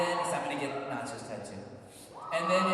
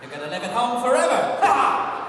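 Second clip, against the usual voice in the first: loud laughing jab; laughing tease; 6 semitones higher, 11 dB louder than usual. Real, not acted. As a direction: tease them through a big laugh. Teasing the crowd.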